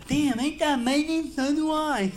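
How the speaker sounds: very high impeded voice; speech impediment voice. Retold or imitated. Customer amazed the bartender talks like him too, very high. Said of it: "a little speech impediment".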